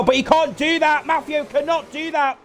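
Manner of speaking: high, loud insistence; excited protest. Real, not acted. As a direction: protest it, all fired up. Illegal pit overtake, protest.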